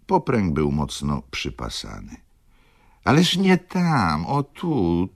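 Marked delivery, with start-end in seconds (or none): 3.0-5.1 high, drawn-out exasperated correction; impatient correction. Acted. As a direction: correct him impatiently, pitched high and stretched out. Widow impatiently directing him at saddle. (zniecierpliwione, wysokie pouczanie)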